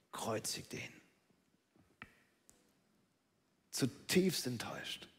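low hushed whisper. Acted. Preacher whispers the crowd's turn to the congregation. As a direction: hush it down to a whisper.

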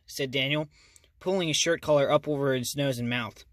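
usual speaking voice

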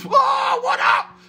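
surprised happy yell; excited shout. Real, not acted. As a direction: shout out to a friend who just joined. Greeting friends on live stream.

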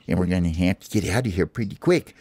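usual speaking voice

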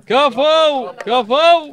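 loud high cheer; cheering shout. Pretend. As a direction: cheer them on at full voice. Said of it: "قولوا كفو وايد عشان يزيد". (هتاف عالٍ)

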